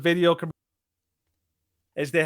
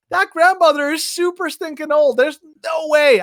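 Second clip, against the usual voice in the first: higher, louder disbelieving shout; incredulous shout; 10 semitones higher, 5 dB louder than usual. Retold or imitated. Reacting to the commercial's grandma, incredulous shout. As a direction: yell your disbelief at the absurd ad. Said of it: "I'm like".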